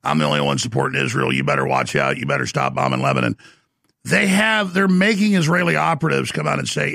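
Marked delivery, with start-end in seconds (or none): 0.0-3.4 much lower, mocking caricature voice; mock deep voice. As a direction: drop the voice way down to mock him. Mocking a pro-Israel figure.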